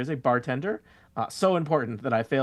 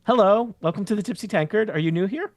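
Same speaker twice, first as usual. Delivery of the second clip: bright, higher barmaid greeting; cheerful bartender; 8 semitones higher, 3 dB louder than usual. Acted. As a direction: welcome them in like a friendly barkeep. GM voices the bartender.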